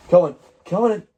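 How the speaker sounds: high, rising call to the dog. Real, not acted. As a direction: coax the dog in, sing-song. Calling the dog in.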